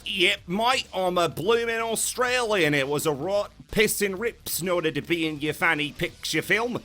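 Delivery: cheeky mock Australian rant; Cockney-Australian impression. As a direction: play the bloke in an overdone Australian accent. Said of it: "To which he responded with".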